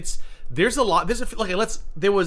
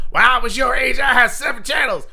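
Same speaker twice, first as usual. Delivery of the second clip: put-on high, loud, bossy old-nanny shout; 9 semitones higher, 6 dB louder than usual. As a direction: belt it like a scolding sixties nanny. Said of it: "cosplaying as their, their nannies from the sixties".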